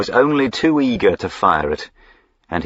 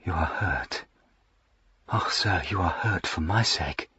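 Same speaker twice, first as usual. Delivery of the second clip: put-on softer, trembling girl's voice; a lightly voiced girl, softer and tremulous; tremulous, husky; 9 dB quieter than usual. A narrator's reading. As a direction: let it tremble softly. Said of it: "A tremulous, husky voice".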